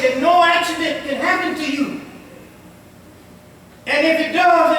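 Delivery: usual speaking voice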